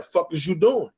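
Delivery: Nala scolding. Retold or imitated. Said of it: "and Nala came over there".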